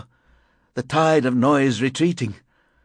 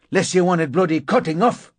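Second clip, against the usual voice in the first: high, loud threat; angry shout; 7 semitones higher, 4 dB louder than usual. A narrator's reading. Narrator running downstairs past neighbour, angry shout. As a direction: yell a threat over your shoulder. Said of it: "I shouted".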